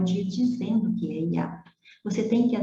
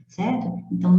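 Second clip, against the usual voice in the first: ordinary delivery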